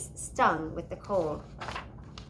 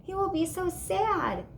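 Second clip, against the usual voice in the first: sad; 8 semitones higher than usual. A narrator's reading. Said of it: "Sophie said".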